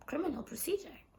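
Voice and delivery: fast, whispered question about "Criminal Procedure Act"; whispered disbelief. Retold or imitated. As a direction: whisper it to yourself in alarm. Inner thought reading ticket.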